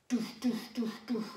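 soft mouthed bass thuds; beat drop imitation. Shown as a demonstration. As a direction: mimic the bass drop quietly. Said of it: "and then you'll hear, like, a".

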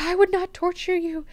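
high-pitched retold denial; lightly acted woman's voice; mock woman's protest. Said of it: "And she's like".

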